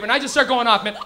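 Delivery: ordinary talk, nothing marked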